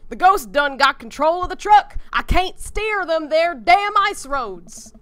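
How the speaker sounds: put-on Southern accent. Streamer voices the ghost-trucker twins in a loud hammed-up Southern accent. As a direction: yell it like a panicked Southern trucker. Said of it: "that's a Southern accent, like, no question".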